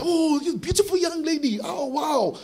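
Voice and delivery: high-pitched, effusive admiration; gushing, very expressive. Acted. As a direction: gush over someone with drawn-out delight. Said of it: "we're very emotion expressive".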